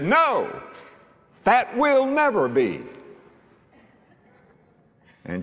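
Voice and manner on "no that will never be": high, emphatic refusal, retold; arguing. Preacher voicing Peter contradicting Jesus, arguing. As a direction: protest as Peter would, pitched well up. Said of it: "Peter was arguing".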